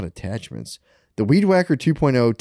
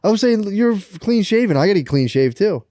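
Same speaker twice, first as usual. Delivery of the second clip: ordinary talk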